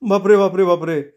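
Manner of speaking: high, quick-repeated cry of alarm; high-pitched fleeing boy; alarmed exclamation. A narrator's reading. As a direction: blurt it out in a high panic. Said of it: "असं म्हणत चमन्या धावत सुटला".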